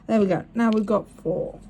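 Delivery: ordinary delivery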